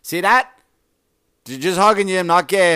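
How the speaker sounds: macho hug voice. Retold or imitated. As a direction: shout it like a tough guy slapping backs. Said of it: "the louder the slap is like".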